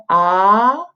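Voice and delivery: AH sliding upward; second tone. Shown as a demonstration. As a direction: Mandarin second-tone "á", long and rising. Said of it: "go up".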